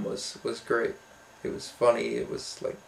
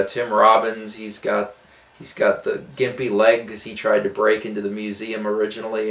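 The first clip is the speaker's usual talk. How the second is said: normal talking voice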